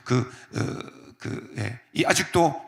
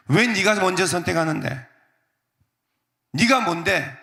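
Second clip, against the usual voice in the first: higher, challenging retort; mock indignation; 5 semitones higher than usual. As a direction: pick a petty fight. Mock selfish quarrel with nephew. (조카에게 따지듯 비꼬는 말투로)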